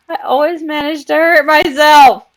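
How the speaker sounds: loud, high-pitched self-mocking cry; amused exclamation. Real, not acted. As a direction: exclaim loudly at your own clumsiness. Exclaims, laughing off her fall.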